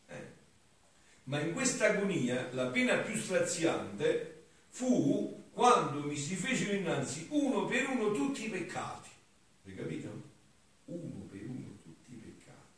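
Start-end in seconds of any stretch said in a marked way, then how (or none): 1.2-9.1 raised, loud declaiming voice; higher-pitched oratorical reading aloud; solemn devotional reading. Acted. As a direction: declaim the sacred text with fervour. Reads devotional text aloud.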